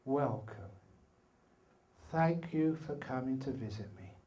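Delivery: soft, gentle welcome to an unwelcome guest; kind welcome. Acted. Emperor softly welcoming the stinking demon, kind and calm. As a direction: greet him softly and kindly, no fear. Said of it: "He said to the demon".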